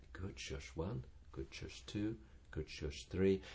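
soft, counted praise to the dog; quiet counting praise. Shown as a demonstration. Trainer to quiet dog, soft counted praise. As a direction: count the shush softly. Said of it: "try it out and go".

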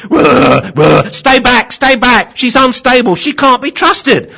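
frantic high shouting, starting with barks; barked warning. Acted. Dog warning other dogs off its owner, high frantic shout. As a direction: yell a desperate warning to keep away. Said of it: "warn the other dogs to keep away".

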